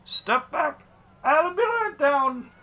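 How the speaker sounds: high, carrying character shout; loud, high-pitched warforged coachman call. Acted. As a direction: holler a warning down from a carriage. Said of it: "he calls down to you".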